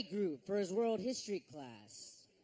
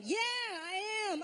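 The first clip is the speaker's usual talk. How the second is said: high, drawn-out hammed-up excitement; over-the-top stretched squeal of agreement; mock enthusiasm; 9 semitones higher, slower than usual. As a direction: cry it out in exaggerated glee. Mock reply to interviewer's question.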